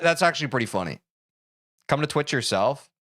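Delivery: ordinary talk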